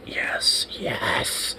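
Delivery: hushed, breathless yes; gleeful whisper. Retold or imitated. Worker in the back quietly cheers the coworker, whispered. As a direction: whisper it in secret triumph.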